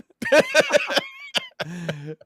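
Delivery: high squealing burst of laughter. Real, not acted. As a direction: shriek with laughter.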